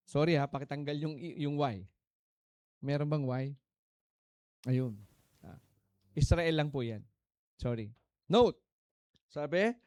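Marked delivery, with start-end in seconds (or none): none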